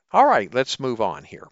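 ordinary talk, nothing marked

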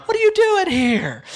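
high, loud hammed-up scolding; mock a voice telling him he's unworthy; mocking the voice of unworthiness; mock condemning voice. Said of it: "But it's like".

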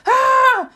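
high, loud "ah" yell; imitated panicked scream; mock scream. Podcaster acting out the cupboard panic, mock scream. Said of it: "my dad starts screaming at me".

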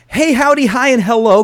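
bright, loud show opener; cheery greeting. Acted. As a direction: greet the audience big and high.

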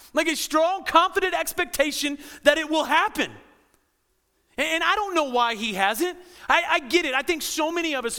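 high, loud, driving pulpit voice; full-force preaching. Acted. Pastor rallying burned-out listeners, full-force preaching. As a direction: hammer the certainty home.

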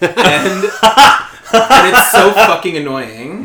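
loud laughing rant. Real, not acted. Hosts laugh off annoyance at Xander, loudly. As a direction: laugh through the complaint, loud.

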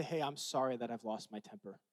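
quiet, gentle sorry; soft apology. Retold or imitated. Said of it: "I say".

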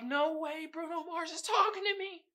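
1 imitating smitten old woman. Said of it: "Was thinking like".

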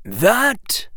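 high, drawn-out single word; mild, lifted opening to a reply; mildly. Acted. Professor Quirrell replying to Harry, mildly. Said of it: "said Professor Quirrell mildly".

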